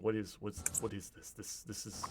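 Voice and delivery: hushed, halting confusion; unnerved. Acted. Bertram looks around at the sound, hushed and unnerved. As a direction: stammer softly, fading to almost nothing. Villain unnerved by unseen voices.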